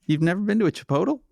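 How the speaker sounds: high, fast disbelief; can't believe it, pitch up; surprised. Real, not acted. To a cohost who never ate there, high and fast surprise.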